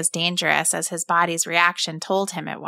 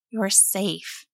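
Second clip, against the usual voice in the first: softer, soothing repeat; soft reassurance; 6 dB quieter than usual. A narrator's reading. Mother holds her crying son, softly reassuring. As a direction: murmur it again to calm him. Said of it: "she told him".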